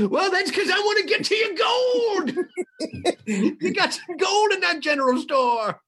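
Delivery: high, cackling gold-greedy prospector; old prospector. Acted. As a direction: crow it through cackles like a crazed old-timer. Replies as the old prospector character.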